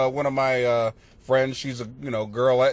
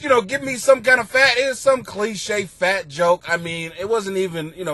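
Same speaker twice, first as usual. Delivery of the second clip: usual speaking voice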